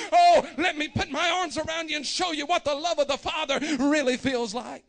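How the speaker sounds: loud, high, fervent preaching shout; full-force preaching. Acted. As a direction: preach it out to the back row.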